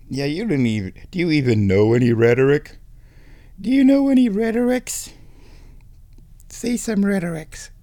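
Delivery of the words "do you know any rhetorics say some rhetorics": high, coaxing pet voice. Real, not acted. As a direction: ask it high, as if to a kitten. Coaxing the cat to speak.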